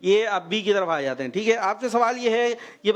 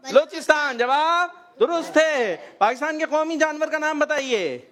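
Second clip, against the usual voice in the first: quiz show announcer; 6 semitones higher than usual. Real, not acted. Host confirming a child's answer, announcer style. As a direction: stretch the verdict out for the audience.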